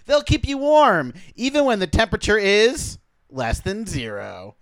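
ad read. Acted. Mock sponsor read.

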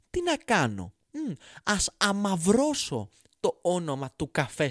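scheming founder voice. Retold or imitated. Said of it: "λέει αυτός που ίδρυσε την εταιρεία αυτή".

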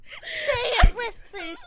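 high, loud, drawn-out call; mock rallying cry. Co-host mimics stranger rallying passengers, mock rallying cry. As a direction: echo the stranger as a big slow chant.

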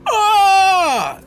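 high, loud, drawn-out scream; imitated cry of someone screaming; distant victim's scream. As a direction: let out one long piercing "aah". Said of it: "you hear another".

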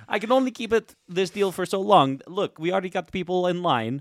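high-pitched Arabian haggler; Arabian market trader. Acted. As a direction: press the sale in an Arabian accent. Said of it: "he's like an Arabian market trader".

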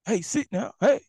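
high, loud calls to a pulling dog; dog owner calling. Retold or imitated. Owner calling his pit bull to stop, high and loud. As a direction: bark out hey like a dog owner reining in. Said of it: "he'll say".

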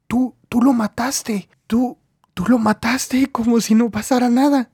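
horrified stammer. Acted. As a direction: stammer it out in shock.

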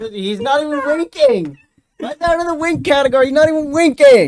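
high, loud squeaky outrage; squeaky indignant. Pretend. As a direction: whine it in a squeaky voice. Mock indignant about gif.

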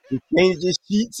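mock New Orleans mama voice; New Orleans accent. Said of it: "In her New Orleans accent".

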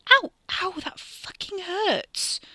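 high, slow, drawn-out wail of pain; yelp, then stretch out the complaint in pain; pained cry. Acted. Katie complains as the pain sparks again, pained cry.

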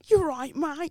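high, overdone movie British "mate"; fake British accent. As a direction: ham up a Hollywood Cockney. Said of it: "They always like to".

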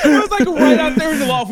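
words through laughter; laughing while talking. Real, not acted. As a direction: speak while still laughing.

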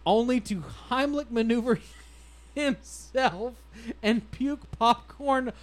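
high, loud reading through laughter; barely holding the laugh in; cracking up. Real, not acted. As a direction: fight rising giggles while reading on. Reader cracking up at the story.

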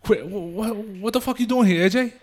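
loud, high angry Jersey father; Tony Soprano impression. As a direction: scold the son like a mob boss. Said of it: "That's exactly how they sound".

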